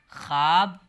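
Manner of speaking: ख्वाब as one long syllable, "khaab"; correct silent-wao reading. Shown as a demonstration. Teacher drilling fluent reading, ख्वाब said "khaab", w silent. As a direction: read ख्वाब without any w sound. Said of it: "ख्वाब नहीं पढ़िएगा आप लोग".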